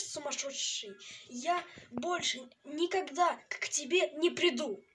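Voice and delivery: normal talking voice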